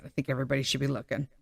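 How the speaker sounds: "I think everybody should be looking" rushed, low and soft under the breath; fast low mumbled repeat; muttered aside. Shown as a demonstration. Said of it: "I'm just saying".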